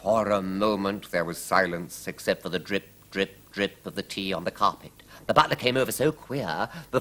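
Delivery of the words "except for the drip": ordinary delivery, nothing marked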